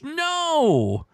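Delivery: high, drawn-out falling no; emphatic rejection. Acted. Host rejecting the guess of S, drawn-out rejection. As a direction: hold it high, then swoop down.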